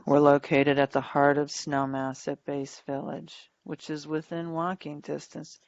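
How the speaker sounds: bored flat read with no emphasis; half dead. Shown as a demonstration. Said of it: "it sounds like I'm half dead".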